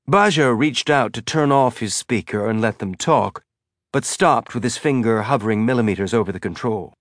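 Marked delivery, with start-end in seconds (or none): none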